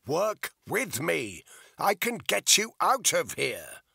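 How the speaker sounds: slow, loud pleading shout. A narrator's reading. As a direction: plead with her at full voice. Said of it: "he shouted".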